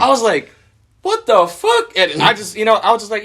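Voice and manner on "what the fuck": very high incredulous squeal; mock shock. Driver reacting to friend littering, comically shocked. Quoting his shock at thrown bag.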